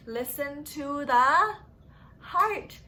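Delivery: slow build, then heart landed high and loud; hint that swells onto heart; drawn-out prompt. Shown as a demonstration. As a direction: stretch it out, then hit heart. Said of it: "teacher prompts the answer".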